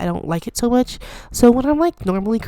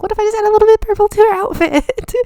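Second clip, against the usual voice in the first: much higher, teasing little voice of temptation; a high mischievous whisper-voice pushing for more purple, hammed-up; squeaky tempted inner voice; 3 dB louder than usual. Talking herself into more purple: high, sly inner voice. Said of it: "I was like".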